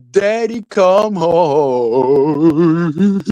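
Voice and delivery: mocking crying girl. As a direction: whine it like a child calling out. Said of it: "and saying".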